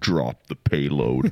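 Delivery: low, slow pilot's order; mock military command. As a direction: play a grave pilot giving the order. Jokingly voices the pilot's order.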